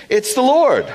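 high, loud exclamation; excited cry. Retold or imitated. Disciple shouting to Peter in the boat, high and excited. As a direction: cry it out in sudden recognition.

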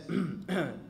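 a polite throat-clear before speaking; mock formal ahem. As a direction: clear the throat to get attention. God about to speak.

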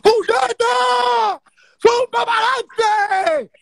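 high, loud, drawn-out cry with laughter; ecstatic yell. Real, not acted. Cheering the stream's drama, a loud high cry of joy. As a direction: let the whole scream out at last. Finally lets out the scream.

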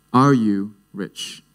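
ARE YOU RICH at half speed; slow, weighty repeat. Acted. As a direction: ask it again slowly, spacing out the words. Question repeated slowly for weight.

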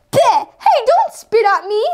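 usual speaking voice

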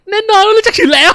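very high, loud put-on boast; boastful character voice. Character claims to know Saitama, high loud boast.

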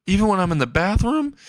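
high alarmed disbelief; high shocked question. Acted. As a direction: squeak it in alarm.

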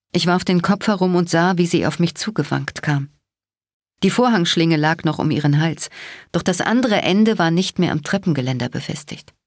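usual speaking voice

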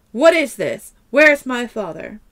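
loud, angry demand. Acted. Daughter confronting the man about her father, loud angry demand. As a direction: demand it, furious. Madison confronts Otis angrily.

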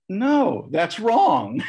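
high, loud verdict, acted out; emphatic rejection. Priest turning down his answer at the rail, emphatic rejection. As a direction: pounce on it as the mock-stern examiner.

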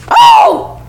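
sudden very high shriek; alarmed shriek. Real, not acted. Player locked in by her, high alarmed shriek. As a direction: shriek as the trap shuts.

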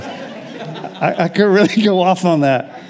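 laughing. Real, not acted. Laughing at own rant.

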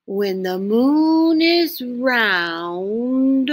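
a very slow, drawn-out lizard voice. Acted. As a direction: speak slowly like an ancient lizard. The lizard speaks.

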